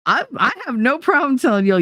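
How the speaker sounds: normal talking voice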